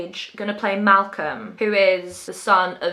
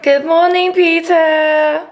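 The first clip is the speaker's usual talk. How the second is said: bright, high and louder hello to the cat; high sing-song greeting; 8 semitones higher, 8 dB louder, slower than usual. Real, not acted. Greets her cat Peter in a high loud voice. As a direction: sing good morning up high.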